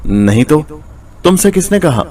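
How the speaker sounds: a startled wife caught out, mildly frightened; flustered. A narrator's reading. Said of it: "बीवी ने घबराकर कहा".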